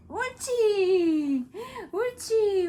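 loud, slow encouraging praise; squeaky praising a dog. Real, not acted. Encouraging baby during tummy time.

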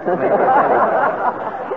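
sudden high laugh breaking up on air; burst of laughter. Real, not acted. Host cracks up mid-interview.

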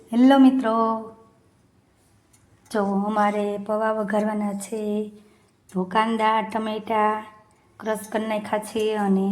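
calm cooking-show walkthrough; vlog presenter voice. Acted. Mother greeting viewers in a calm vlog voice.

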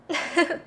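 short bright giggle; a quick charmed little laugh; playful girlish giggle. Acted.

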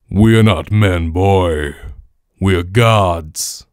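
put-on slow, low, loud god addressing a boy; game character voice. God lecturing a boy in lines from the game, slow booming voice. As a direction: boom it out slowly, stretching each word.

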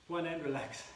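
usual speaking voice

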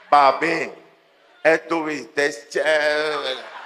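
susurro lento y coqueto, más suave; mujer coqueta. Retold or imitated. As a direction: dile papi con voz melosa. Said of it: "regresan así". (imitando a la mujer, coqueta y lenta)

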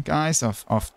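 ordinary talk, nothing marked

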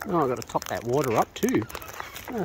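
ordinary talk, nothing marked

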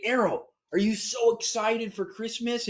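put-on playful daddy voice to a child; excited dad voice. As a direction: hype up your kid about Christmas. Dad asking son about Christmas.

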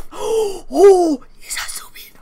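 breathless whispered amazement; stunned, voiceless reaction; gasping shock. Real, not acted. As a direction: gasp out the oh, then whisper. Gasping reaction to the next photo.

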